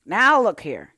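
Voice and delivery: loud, sharp reprimand. A narrator's reading. Gardener suddenly angry with the girl, sharp and loud. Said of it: "he said sharply".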